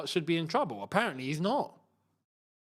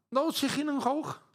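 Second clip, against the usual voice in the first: mock Scouse; 6 semitones higher than usual. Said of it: "I could never do Scouse".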